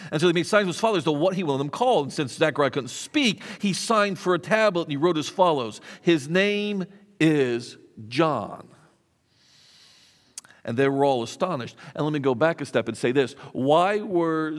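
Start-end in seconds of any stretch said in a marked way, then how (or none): 6.0-8.7 "His name is John" drawn out very slowly, word by word; emphatic declaration. Acted. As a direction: spell out "His name is John" with weighty pauses before "is" and "John". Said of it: "Zechariah is emphatic".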